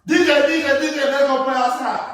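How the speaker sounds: put-on high, loud excited kid calls; kids calling. Cousins calling DJ out to play, in high, loud kid calls. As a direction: call him out like an eager little cousin.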